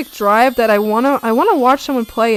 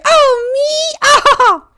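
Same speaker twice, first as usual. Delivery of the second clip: laughing surprise; 7 dB louder, much slower than usual. Real, not acted. Surprised laugh at being called.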